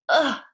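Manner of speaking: loud ugh of disgust; disgusted grunt. Acted. Pippi recalling the snake's squeeze, a loud disgusted ugh.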